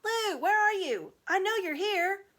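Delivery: loud, high call into the dark; shouting for Lucy. Acted. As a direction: call out for her, growing uneasy. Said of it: "He even shouted out".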